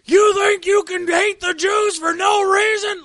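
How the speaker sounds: high, loud indignant shout; angry outburst. Acted. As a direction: roar it in acted outrage. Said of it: "genuinely get angry and be like".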